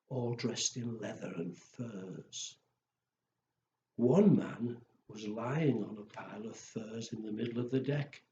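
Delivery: plain reading voice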